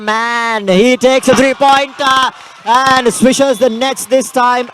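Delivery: rising, drawn-out excited call of a three; slow, high play-by-play thrill. Real, not acted. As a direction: let the voice climb as the shot drops in. Big three-pointer swishes the net.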